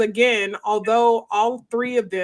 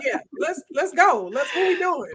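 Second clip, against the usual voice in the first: mock hype; 8 semitones higher than usual. Fighter acting ready to go.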